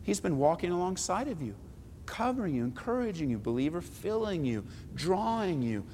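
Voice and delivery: soft, gentle invitation to return; quiet pastoral appeal. Real, not acted. Pastor gently urging believers to return, soft and quiet. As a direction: draw them back softly.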